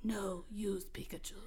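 soft whispered distant voice. Acted. The mysterious voice tells Nash, a distant whisper. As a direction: whisper it like a far-off voice. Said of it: "but the voice said".

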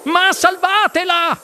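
high, drawn-out yell for help. A narrator's reading. Mrs Salt begs for her daughter's rescue, yelling. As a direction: yell it out, pitched right up. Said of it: "urlò la signora Salt".

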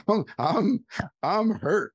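real laugh, then words pushed out high; giggling through the words. Real, not acted. Retelling how his dad laughed, laughing.

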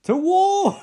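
high, loud mock battle scream; comic rallying shout; Raphael. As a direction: yell a hammed-up war cry. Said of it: "Raphael screams".

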